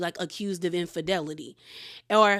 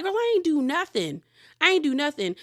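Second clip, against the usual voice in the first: mock defensive denial; 6 semitones higher than usual. Heather denies it to the ladies.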